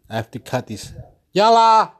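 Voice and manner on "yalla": loud high call to the door. Real, not acted. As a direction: shout it out to someone outside. Calling out to neighbour.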